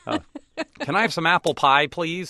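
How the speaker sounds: put-on high, loud, hammy all-American boy; goofy galoot proving he's American; all-American kid. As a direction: lay the apple-pie American on thick. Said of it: "I'm like".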